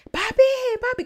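"Papi, papi" yelled high and loud in a panic; desperate yelling. Retold or imitated. A girl calling her father at night, desperate high yell. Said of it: "como desesperada, gritándole".

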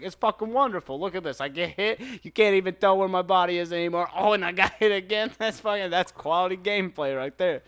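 imitating over-the-top fake praise; obvious trolling. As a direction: gush sarcastically as an obvious troll. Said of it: "That's how you know it's obvious trolling".

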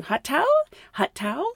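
light, rising offer of "hot towel"; soft, sing-out cabin crew courtesy; smiling service voice. Retold or imitated. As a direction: offer it with a polite smile.

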